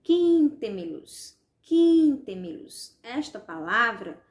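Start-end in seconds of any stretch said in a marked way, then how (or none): 0.0-3.0 "Quíntemelos" with QUIN held long, high and loud; long loud QUIN, then let the rest drop; stress on QUIN. Shown as a demonstration. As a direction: call out "Quíntemelos", stretching the first syllable. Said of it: "O quin é a sílaba tônica".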